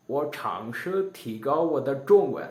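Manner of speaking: ordinary talk, nothing marked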